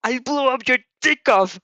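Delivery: loud, high panicked pleading; frantic shouted plea; panicked yell. Acted. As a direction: yell it like you're about to be blown up. Mineta panicking, Bakugo yelling.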